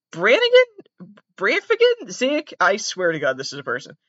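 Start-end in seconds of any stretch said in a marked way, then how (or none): 0.1-2.5 guessing. Real, not acted. Straining to remember a name.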